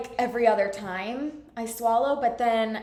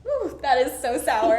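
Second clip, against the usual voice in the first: laughing; 7 dB louder than usual. Real, not acted. Patient tastes sour drop.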